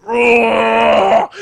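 loud, high straining roar; imitated effort roar, loud and high; strained roar. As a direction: bellow like lifting something impossible. Friend straining through the push-up.

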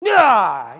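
loud acted cry of exasperation; frustrated yell. Teen alone after slamming his door, frustrated yell. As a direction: let out a mock furious yell.

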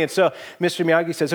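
ordinary delivery

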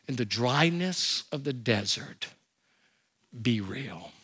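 preacher urging honesty with God, hushed; hushed plea. Real, not acted. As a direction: lean in and say it softly. Sermon climax plea.

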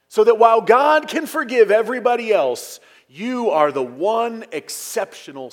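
loud, forceful preaching. Real, not acted. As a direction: ring it out over the whole congregation. Preacher drives home the sarcastic contrast.

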